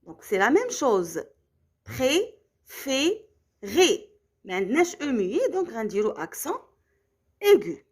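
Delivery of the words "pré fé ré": "Pré, fait, ré" in three separate beats; syllable by syllable. Shown as a demonstration. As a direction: pause between each of "Pré, fait, ré". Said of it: "dans la deuxième syllabe".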